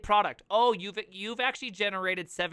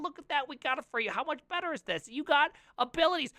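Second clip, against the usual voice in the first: salesman; 8 semitones higher, faster than usual. Pretend. Mock salesman pitch.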